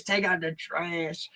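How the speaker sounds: soft, slow, eerie mutter; weird quiet voice. Retold or imitated. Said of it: "Just this weird voice".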